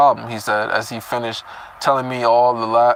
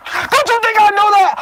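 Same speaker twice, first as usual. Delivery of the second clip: much higher, louder squeaky parody of the snap; mocking Cecilia; 5 dB louder than usual. Reader parodying Cecilia snapping at Nico, high squeaky mockery. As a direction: mimic her outburst like a whiny brat. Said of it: "Just like".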